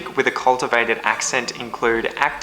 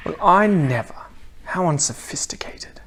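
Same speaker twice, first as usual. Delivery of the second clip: mock-offended cultivated Australian, falling to a hush; prim mock indignation, trailing into a whisper; affronted posh Aussie; 4 semitones higher than usual. Cultivated [name] acts offended.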